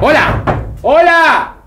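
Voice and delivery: loud, high shout into the house; calling out. Acted. Guardian shouting for someone in the house, loud and high. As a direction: call out loud to whoever is inside.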